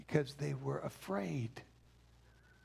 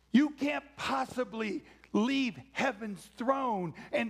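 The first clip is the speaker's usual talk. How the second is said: high, loud, incredulous outburst; flabbergasted and emphatic, pitched up; awestruck disbelief; 6 semitones higher, 4 dB louder than usual. Acted. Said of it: "let me translate that for you".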